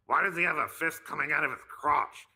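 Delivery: high, loud cartoon teen voice; Beavis and Butthead. Commenting on the host's appearance, loud Beavis impression. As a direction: squawk it like a dim cartoon slacker. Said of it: "do it how Beavis and Butthead would".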